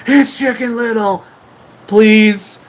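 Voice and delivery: high imitation holler; drunken-style yell. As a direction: holler it like the drunk parade guy. Repeats the drunk guy's yell.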